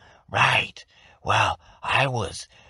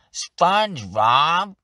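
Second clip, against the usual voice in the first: drawn-out nasal Squidward shout, much higher and louder; slow, high whiny yell of SpongeBob's name; cranky neighbour bellowing at SpongeBob; 7 semitones higher, 5 dB louder, much slower than usual. Retold or imitated. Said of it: "A good nasally character ... is Squidward".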